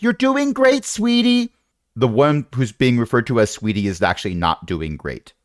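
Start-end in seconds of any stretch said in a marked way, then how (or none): 0.0-1.5 high-pitched cheering mom voice; yelled encouragement. Acted. Mom to her children in the chariot, yelled encouragement. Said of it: "constantly yelling out encouragement".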